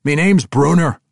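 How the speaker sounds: loud, high proud declaration; interjecting dwarf. Acted. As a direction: cut in with pride.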